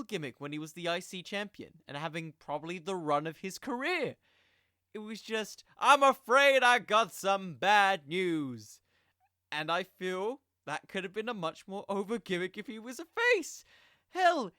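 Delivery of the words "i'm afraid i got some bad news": slow, loud villain announcement; Bad News Barrett catchphrase. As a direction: boom it out slowly like a heel delivering doom. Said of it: "Wade Barrett shouting".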